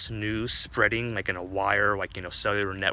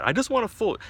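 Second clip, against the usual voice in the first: much higher, louder interrupting demand; whiny insistence; 14 semitones higher, 6 dB louder than usual. Acted. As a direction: cut in with a high, exasperated plea. Dick cuts bartender off, insisting.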